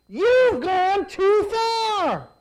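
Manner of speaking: high, loud and very slow accusation; angry rebels. Acted. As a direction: drag each word out in defiance. Rebels confront Moses.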